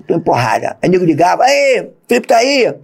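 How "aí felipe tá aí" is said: high, slow, put-on voice of the caller; caller on the phone. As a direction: drag the question out like a stranger phoning. (voz fingida de quem liga, aguda e lenta)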